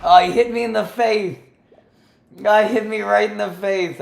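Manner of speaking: high loud yelp of pain; hit hard crying out. Real, not acted. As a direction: cry out as if struck in the face. Arrow hits him in game.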